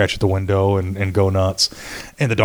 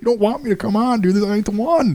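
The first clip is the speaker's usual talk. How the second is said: high-pitched cautioning comeback; animated mock warning; retold warning; 9 semitones higher than usual. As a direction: re-enact warning off a challenger, pitch up. His warning back to the kid.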